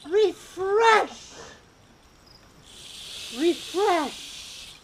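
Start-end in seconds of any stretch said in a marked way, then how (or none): 0.1-1.3 louder. Acted. Repeated word, escalating.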